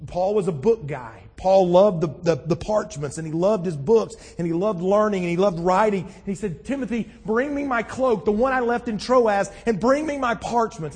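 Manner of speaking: high, loud, impassioned preaching. Acted. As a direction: preach it out with full conviction. Preaches at full force.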